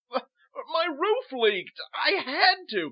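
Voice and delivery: high, loud stammering plea; loud, desperate excuse; frantic protest. Acted. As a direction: plead your case in a panic. Arrested person protests to guards.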